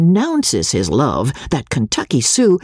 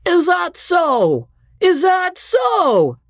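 slow, much higher mocking drunk; drawling heckler; slower than usual. A narrator's reading. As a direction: drunk heckler jeering the actors. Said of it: "its drawling skepticism".

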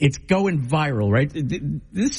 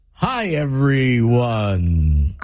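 drawn-out, very slow and lower greeting; mocking slow queen; 6 semitones lower, much slower than usual. Co-host mimics the queen's accentless greeting, mockingly slow. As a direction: stretch every word, imitating a failed accent. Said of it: "You were like this".

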